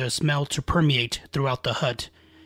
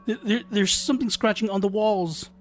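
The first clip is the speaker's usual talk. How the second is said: put-on higher, stammering child in fear; frightened stammer; 6 semitones higher than usual. A narrator's reading. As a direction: stumble over the words in fright. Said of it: "replied the boy".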